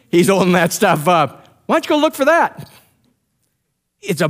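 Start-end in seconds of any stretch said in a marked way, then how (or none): 1.7-2.7 very high-pitched jeering call; imitated gloating challenge, pitched way up; mock taunt. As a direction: toss out a taunt in a much higher pitch. David taunts them holding the spear.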